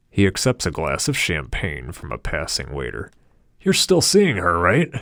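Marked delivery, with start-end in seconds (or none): 3.6-4.9 "You're still seeing her" pitched high and louder; pry with a raised voice; high nosy question. A narrator's reading. Harold asking about Cynthia.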